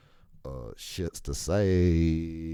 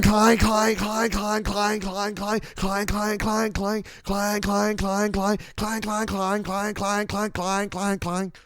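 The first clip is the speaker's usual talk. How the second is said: high, loud repeated clang of a broken cart wheel; cart clanging noise; 6 dB louder, slower than usual. Retold or imitated. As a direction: bang it out like a rattling metal cart. Imitating shopping cart wheel noise.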